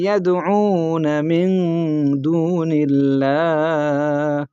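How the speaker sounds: slow, drawn-out melodic tilawat; Quran recitation. Shown as a demonstration. Teacher reciting the verse for students, slow melodic chant. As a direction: chant each word long and sustained.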